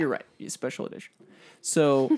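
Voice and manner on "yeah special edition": breathy whispered aside; whispering. Acted. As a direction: whisper it like a secret.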